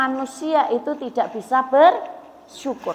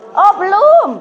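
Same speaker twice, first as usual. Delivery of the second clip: dramatic not yet; 7 semitones higher, 5 dB louder than usual. Acted. Preacher's rhetorical twist.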